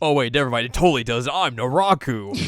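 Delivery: loud, emphatic self-correction; mock denial. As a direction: proclaim the denial loudly as a villain. Villain's loud hurried denial.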